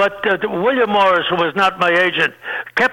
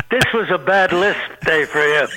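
usual speaking voice

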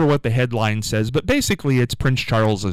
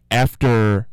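ordinary talk

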